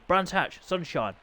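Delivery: ordinary delivery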